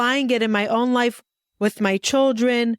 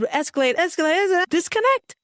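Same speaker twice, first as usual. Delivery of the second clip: each word climbing higher; escalating; 8 semitones higher, faster than usual. Shown as a demonstration. Describing old fights, pitch climbing higher each word. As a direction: ramp the pitch up step by step.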